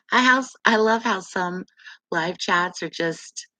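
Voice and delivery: ordinary delivery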